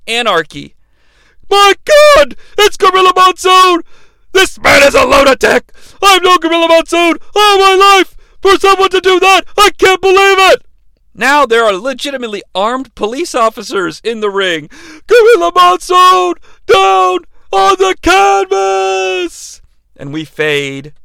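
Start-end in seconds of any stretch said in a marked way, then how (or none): 1.5-10.7 high, loud furious shouts; outraged Vince. Retold or imitated. Announcer berating Vader at ringside, furious shout. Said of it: "Vince standing up and verbally berating". 15.1-19.6 high, loud, slow crying shout; anguished announcer cry. Retold or imitated. Announcer seeing Gorilla fall, slow anguished cry.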